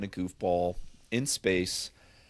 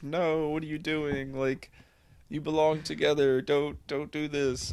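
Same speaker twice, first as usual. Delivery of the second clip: higher-pitched, half-serious plea; mock pleading; 6 semitones higher than usual. Viewer to on-screen couple who belong together, mock pleading. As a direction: imitate an invested viewer begging the couple.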